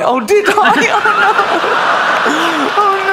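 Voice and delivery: high mock-dismayed kid, laughing through it; horrified child. Child realising she rolled on the puppy, high mock horror.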